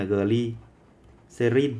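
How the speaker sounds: slow, spelled-out dictation pace. Shown as a demonstration. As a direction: dictate it slowly for notes. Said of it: "thì ta ghi là".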